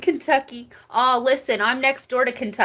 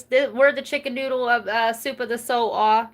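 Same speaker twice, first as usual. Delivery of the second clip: ordinary talk, nothing marked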